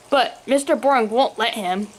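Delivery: usual speaking voice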